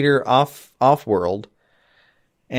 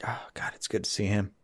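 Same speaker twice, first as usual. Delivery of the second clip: whisper; 3 semitones lower, 12 dB quieter than usual. Real, not acted. Aside while watching the scene.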